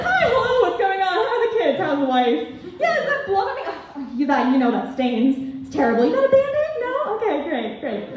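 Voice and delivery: high, fast, giddy host patter with laughs; a demented game show host on adrenaline, beaming; manic game show host. Retold or imitated. Rattled after an ATV crash, chatting up the guide like a demented game show host. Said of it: "I was like some demonic, demented game show host".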